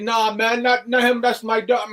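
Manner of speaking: high, easygoing dismissive voice; laid-back buddy voice. Retold or imitated. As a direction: wave it off, no way him. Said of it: "He was like".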